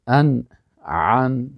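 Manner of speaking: ان with a glottal stop, عن from the throat, very slow; drawn-out contrast of ان and عن; slow teacher demo of ان versus عن; hamza then ain. Shown as a demonstration. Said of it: "الف، همزه و عین".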